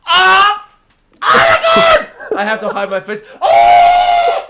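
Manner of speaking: very high, loud ecstatic shrieks; real excited scream. Real, not acted. Hearing Emma Stone might return, real delighted scream. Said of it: "I had to jump away from my camera".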